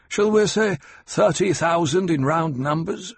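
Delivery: Hawat, sharp and knowing. Acted. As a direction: lay out the facts to corner him. Hawat cuts in.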